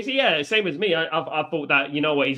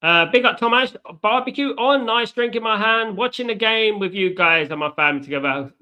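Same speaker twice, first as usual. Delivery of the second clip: excited shout-out; 7 semitones higher, 4 dB louder than usual. Real, not acted.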